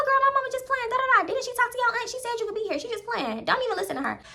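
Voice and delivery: put-on high-pitched girlfriend voice, dismissive; put-on high voice waving away worry; imitated friend reassuring. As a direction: brush it off in a high voice. Friend reassures her about mama.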